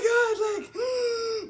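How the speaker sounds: sharp high crying gasp; high sobbing gasp. Retold or imitated. Gasping sob, crying alone in the shower. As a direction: gasp like she's bawling in the shower.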